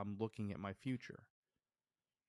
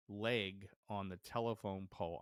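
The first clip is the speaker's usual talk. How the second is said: normal talking voice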